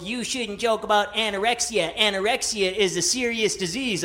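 lightly voiced scolding listener; a hint of preachy reproach; lecturing critic. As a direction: imitate a mild earnest telling-off. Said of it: "I got lectured".

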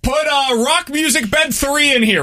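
mock producer voice. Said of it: "Do you think the producer's like".

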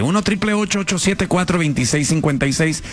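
ordinary talk, nothing marked

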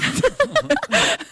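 high-pitched burst of laughter; amused high laugh. Real, not acted. Laughs at being caught out.

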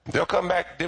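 ordinary talk, nothing marked

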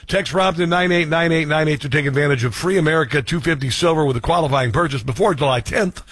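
ordinary talk

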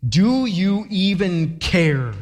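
disbelieving mock demand; audacious complaint. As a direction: act out their nerve, stretching each word. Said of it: "The audacity that they would come".

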